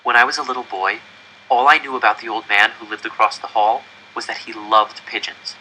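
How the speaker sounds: ordinary delivery, nothing marked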